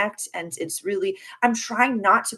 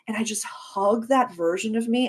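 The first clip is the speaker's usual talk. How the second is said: ordinary talk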